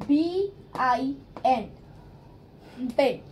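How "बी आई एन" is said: slow spelling. Shown as a demonstration. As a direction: spell बी आई एन slowly, letter by letter. (अक्षर-अक्षर धीरे बोलना)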